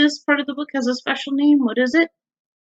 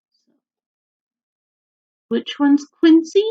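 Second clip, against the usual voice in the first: usual speaking voice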